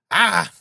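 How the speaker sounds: high startled cry; shrill-pitched scream of shock; sudden scream. A narrator's reading. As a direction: let out a sudden frenzied shriek. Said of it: "uttered a sudden scream".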